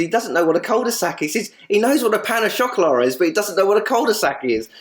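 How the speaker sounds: high-pitched teasing disbelief; imitating comic indignation; mock exasperation. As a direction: retell a ribbing complaint, pitch well up. Retold teasing complaint about friend's son.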